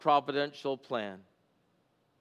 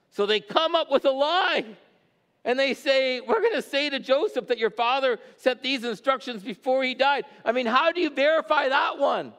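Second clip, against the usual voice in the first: higher, louder, fervent preacher; preaching at full force; 10 semitones higher, 4 dB louder than usual. Acted. Pastor preaching the brothers' lie to his congregation, fervent. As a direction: drive the story home to the congregation.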